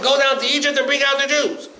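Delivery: God sends Moshe to Egypt, a loud high command. Acted. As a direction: boom out the divine order.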